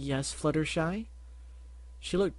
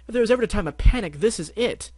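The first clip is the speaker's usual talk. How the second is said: louder, higher, fast; panicked outburst; 9 semitones higher, 9 dB louder than usual. Acted. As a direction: blurt it out in panic. Rarity panics loudly.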